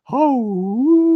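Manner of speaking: imitating a high, ringing forest whoop; a loud, high whoop thrown across the ridge; high echoing whoop call. Whooping from the ridgetop to call back a Sasquatch. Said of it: "It was beautiful, echoed".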